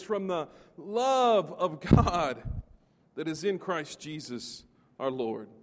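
building proclamation, slowing on the climax; scripture reading. Acted. Preacher proclaiming Romans to the church, building then slowing. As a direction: read it like a promise you'd stake your life on.

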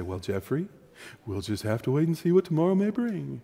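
a patient mother putting her son off; mother's voice. Retold or imitated. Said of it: "And she would say".

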